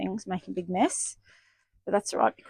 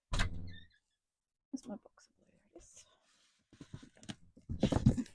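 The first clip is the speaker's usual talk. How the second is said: low slow whisper to herself; 13 semitones lower, 6 dB quieter, slower than usual. Real, not acted. Searching cupboard.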